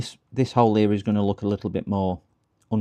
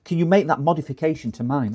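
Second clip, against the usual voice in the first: loud, gushing fake admirer; mock amazement; 4 semitones higher, 7 dB louder than usual. Repairer voicing viewers amazed at his repair, mock awe. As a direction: open on a big raised wow, then keep gushing. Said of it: "You'll all go".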